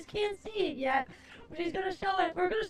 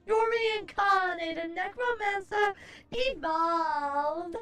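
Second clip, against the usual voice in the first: slow theatrical necromancer greeting; grand villainous host welcome; horror host; slower than usual. Acted. Persona greeting opens stream.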